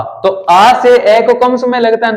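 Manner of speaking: normal talking voice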